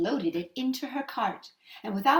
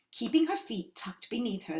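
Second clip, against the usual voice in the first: ordinary delivery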